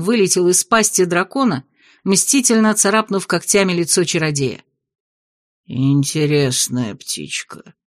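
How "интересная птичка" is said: lower, slow menacing voice; sinister mage. Acted. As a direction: savour it like a dangerous sorcerer. Dark mage speaks, new character.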